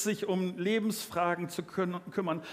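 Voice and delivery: usual speaking voice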